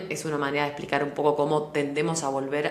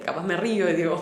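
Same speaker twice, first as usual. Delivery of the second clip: usual speaking voice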